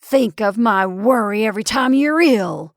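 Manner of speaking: loud, indignant complaint. Acted. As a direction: press the grievance hard. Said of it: "pursued the indignant Mister Gribble".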